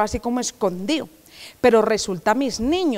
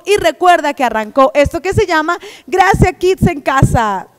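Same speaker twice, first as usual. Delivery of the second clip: louder, higher, bright announcing; excited announcement; 5 semitones higher, 6 dB louder than usual. Real, not acted. Host announcing Gracia Kids to children, excited. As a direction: call out the program name with energy.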